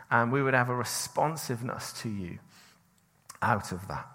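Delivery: a pastor praying over the church before teaching; prayer. Acted. Prays over the congregation.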